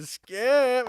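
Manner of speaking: high, loud drawn-out call; female crew members. Retold or imitated. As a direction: imitate women cheering from across the set. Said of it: "female crew members over there going".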